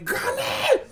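high, loud scream for grandmother; frantic high-pitched yelling; panicked scream. Retold or imitated. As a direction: shriek for help in full panic. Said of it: "I'm full panic".

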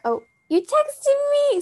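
high and slow, caught off guard; oh, as if a text just pinged in; sudden surprise. Real, not acted. Vlogger notices her friend's text arriving mid-video, surprised and high.

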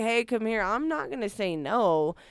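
usual speaking voice

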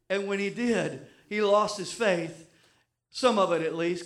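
normal talking voice

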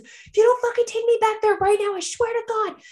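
very high, loud furious threat; mock scream. Woman in labor screaming threat at husband, very high. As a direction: scream the ultimatum at him. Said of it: "I start screaming at him".

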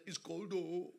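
put-on light Nigerian accent, said softly with a laugh; a hint of a Nigerian man complaining of the cold. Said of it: "in a typical Nigerian accent".

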